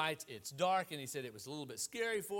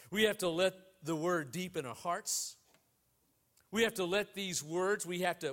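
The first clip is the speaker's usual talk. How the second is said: strong, louder preaching voice; building sermon exhortation; 5 dB louder than usual. Acted. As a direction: press the point home to the congregation. Preaching at full force.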